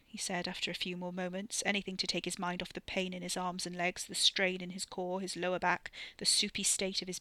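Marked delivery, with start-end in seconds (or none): none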